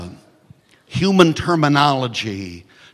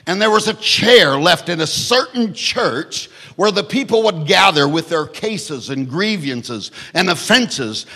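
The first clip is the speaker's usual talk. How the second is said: normal talking voice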